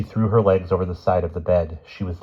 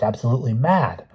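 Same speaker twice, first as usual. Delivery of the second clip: hissed; 6 semitones higher than usual. A narrator's reading. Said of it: "Judith hissed".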